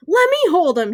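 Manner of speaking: put-on high, eager child's plea; pleading kid. A narrator's reading. Ryan tries to get the mouse from Ben, pleading. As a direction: beg for a turn.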